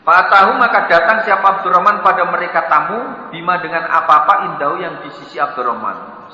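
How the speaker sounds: ordinary talk, nothing marked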